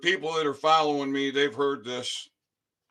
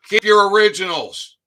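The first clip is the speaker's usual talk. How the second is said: emphatic loud command; 6 semitones higher, 9 dB louder than usual. Real, not acted. Warning his followers, a loud emphatic command. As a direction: keep your originals, said loud and high with force. Urging viewers to keep originals.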